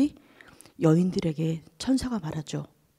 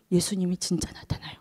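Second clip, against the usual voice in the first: ordinary delivery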